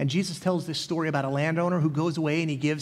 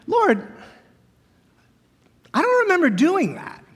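high, incredulous protest, a lot higher and a bit louder; bewildered disciples; 6 semitones higher, 4 dB louder than usual. Retold or imitated. Disciples protesting to Jesus, much higher and baffled. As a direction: pitch it way up in baffled disbelief. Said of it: "They look at him and go".

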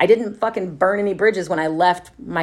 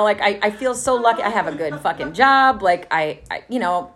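ordinary talk, nothing marked

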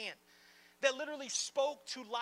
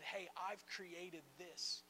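soft, slow, much quieter loving reassurance; tender God; 7 semitones lower, 10 dB quieter than usual. Retold or imitated. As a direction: speak it gently, like a whispered promise. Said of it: "he made this and said".

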